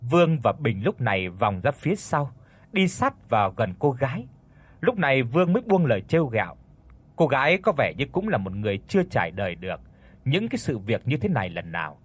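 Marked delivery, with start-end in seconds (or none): none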